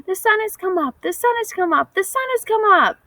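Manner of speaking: high, joyful chipmunk calling; chipmunk's song. A narrator's reading. Chipmunk singing his morning song from the tallest tree, high and joyful. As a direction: sing it out high from the treetop. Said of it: "to sing his song".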